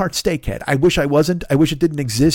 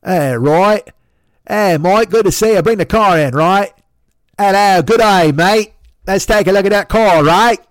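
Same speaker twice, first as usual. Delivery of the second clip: high, loud, broad Australian mechanic greeting; hammed-up Aussie "good day, mate" in Australian; cheery Australian mechanic hailing a customer, loud; Australian accent; 7 semitones higher, 6 dB louder than usual. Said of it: "you know Australian Dave?".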